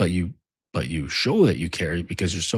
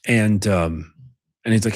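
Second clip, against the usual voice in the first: ordinary talk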